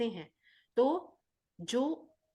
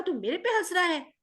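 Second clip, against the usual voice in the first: mock angry; 7 semitones higher, 4 dB louder than usual. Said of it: "Bogard को गुस्सा आया".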